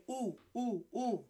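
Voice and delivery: "u" as a pure, soft oo; vowel drill. Shown as a demonstration. Teacher leading everyone in the vowel u, pure oo. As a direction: say "u" as oo, each one separate. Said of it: "Everyone say".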